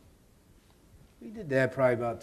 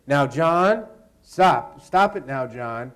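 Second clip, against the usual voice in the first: raised, firm stop it now; firm command; 9 dB louder, much slower than usual. Retold or imitated. Kathy scolding John in the scene, raised voice. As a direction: order him to stop. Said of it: "Kathy's supposed to say".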